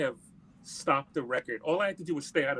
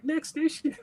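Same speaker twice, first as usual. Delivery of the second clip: shocked disbelief; 14 semitones higher than usual. Retold or imitated. Retelling shock at magazine news.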